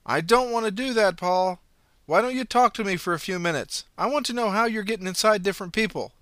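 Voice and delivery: loud calm-down call. Acted. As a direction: keep her talking from a distance. Police officer calling to suspect.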